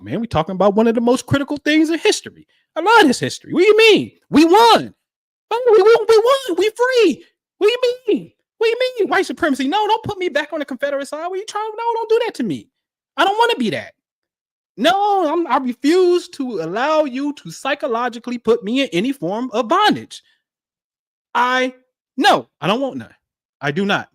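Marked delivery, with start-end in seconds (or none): 0.0-13.9 high, loud, fired-up outburst; riled up. Real, not acted. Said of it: "getting a little riled up". 14.8-23.1 raised, heated protest; riled up. Real, not acted. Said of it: "getting a little riled up".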